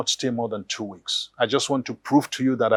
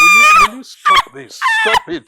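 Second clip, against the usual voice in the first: furious shout; 13 dB louder than usual. Acted. Husband yells at wife.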